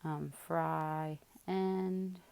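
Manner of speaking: "fry end" said slowly as two clear words; slow spelling memory aid. Shown as a demonstration. Teacher shows a spelling trick for the word, slow and clear. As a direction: drag out "fry end" so each part stands out. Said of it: "remembering that it is".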